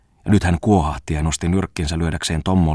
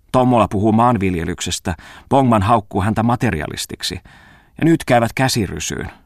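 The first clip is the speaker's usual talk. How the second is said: usual speaking voice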